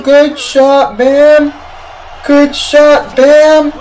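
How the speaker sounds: high, shouted praise; real hyped fan shout; excited yelling. Real, not acted. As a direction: cheer the player at the top of your range. Cheering Bam's shot.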